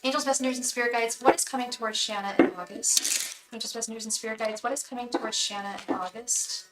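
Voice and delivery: soft, chant-like repeated invocation; quiet ritual chant of a tarot reader. Acted. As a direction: intone the question to the spirit guides.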